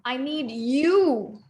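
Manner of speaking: YOU drawn out with a rise and fall; slow line that lands on YOU; stress on you. Shown as a demonstration. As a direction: save the weight for the final YOU. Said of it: "I want you to tell".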